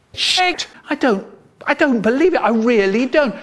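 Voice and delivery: can't believe what he's reading; boy stunned by the inspector's message; shocked disbelief. Acted. Shocked by the note.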